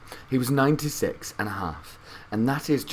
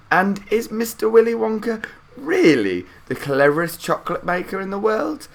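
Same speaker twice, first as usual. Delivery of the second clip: put-on a higher, lighter boy's voice, curious; Charlie asking; 12 semitones higher, 5 dB louder than usual. A narrator's reading. Charlie asking Grandpa Joe about Wonka, curious boy's voice. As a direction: wonder aloud like a small boy.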